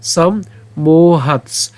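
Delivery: সম্মোহাৎস very slow, a break after "sam"; syllable demo. Shown as a demonstration. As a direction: stretch "mo" in সম্মোহাৎস and close on "hats". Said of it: "খণ্ডতের সাথে দন্ত উচ্চারণ করবো".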